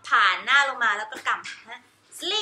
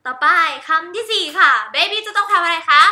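ordinary talk

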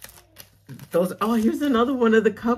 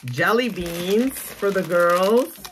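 ordinary delivery

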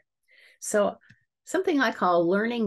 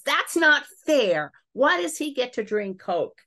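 put-on child's protest; 8 semitones higher, 5 dB louder than usual. Child protesting unfair rule.

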